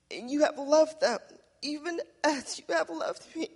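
slow, moved reading of a prayer, voice catching; emotional scripture reading. Real, not acted. Pastor reading Jesus' prayer to the church, deeply moved. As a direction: read the Gospel prayer as if it moves you.

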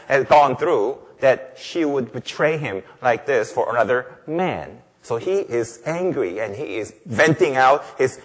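normal talking voice

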